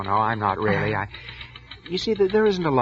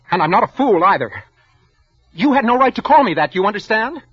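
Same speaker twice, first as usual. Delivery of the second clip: higher and louder, rising to a shout; angry outburst; 7 semitones higher, 4 dB louder than usual. Acted. Meek clerk shouts back at his insulting boss, angry and raised. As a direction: finally stand up to the boss.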